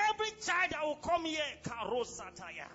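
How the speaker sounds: declaring prayer. Shown as a demonstration. Husband blessing wife's womb, loud declaration. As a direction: proclaim it over the womb. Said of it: "put your hand on her womb and say".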